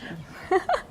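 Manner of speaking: short high laugh; self-conscious giggle. Real, not acted. Guest laughing at herself for talking too much. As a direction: laugh at yourself.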